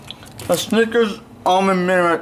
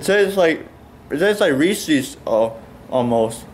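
chewing while talking; speaking through chocolate bite; mouth full of candy. Real, not acted. Seen 0: Food visible on teeth while speaking; holds bitten candy bar.

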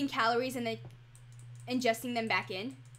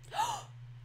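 sharp, very high gasp; surprised gasp; 5 dB quieter than usual. Real, not acted. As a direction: caught off guard. Surprised by something in game.

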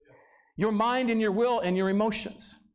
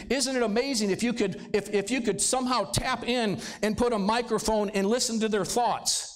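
usual speaking voice

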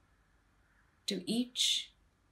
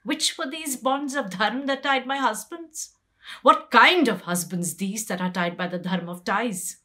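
loud, higher, outraged demand; indignant questioning; 4 semitones higher, 6 dB louder than usual. Acted. Draupadi confronting husbands bound by dharma, loud outraged questions. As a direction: hurl the questions at the absent husbands.

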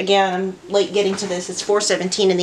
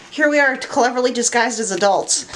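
ordinary talk, nothing marked

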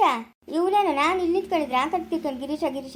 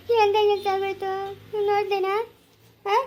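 slow, higher tearful lament; crying; 4 semitones higher than usual. Acted. Mother sobbing to Harisha over how he ended up. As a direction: weep as you point him out.

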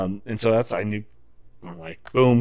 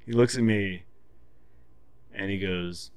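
ordinary talk

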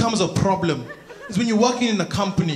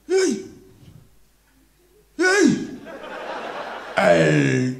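calling out; much slower than usual. Acted. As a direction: call out to someone far away. Hiker calling out across trail.

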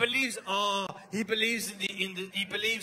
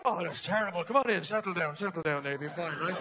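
lower, softening reassurance; soothing dad; 4 semitones lower than usual. Acted. Father calming frightened son, low and reassuring. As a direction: settle a child down, voice dropping.

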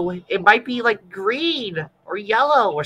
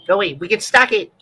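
ordinary talk, nothing marked

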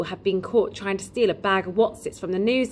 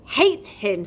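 hot burst of anger, louder; mildly raised, angry boy; heated; 5 semitones higher, 3 dB louder than usual. A narrator's reading. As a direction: punch out the hatred. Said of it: "said Joe hotly".

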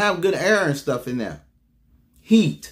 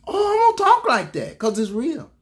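defiant retort; 10 semitones higher than usual. Real, not acted. Pastor defiantly answers his doubting congregation, voice leaping high. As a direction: pitch jumps way up, defiant and emphatic. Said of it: "you shouldn't be talking like that".

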